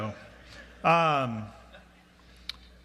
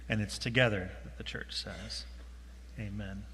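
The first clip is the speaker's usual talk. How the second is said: low, increasingly soft prayer voice; 7 semitones lower, 5 dB quieter than usual. Real, not acted. Pastor leading the church in confession to God, low reverent prayer. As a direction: pray reverently, settling lower and quieter.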